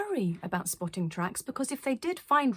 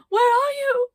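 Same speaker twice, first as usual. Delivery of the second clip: very high, loud, near-crying call; frightened cry; 11 dB louder than usual. Acted. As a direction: cry out lost and scared. Lost in the blizzard.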